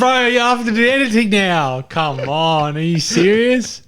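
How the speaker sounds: high, loud mock protest; playful outrage. Real, not acted. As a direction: protest in a joking way.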